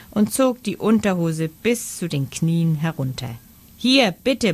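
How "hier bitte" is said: high, raised narrated yell; mild bellow. A narrator's reading. Franz shows the boy his proof, yelling mildly. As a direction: blurt it out defiantly, raised in pitch. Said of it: "brüllte er".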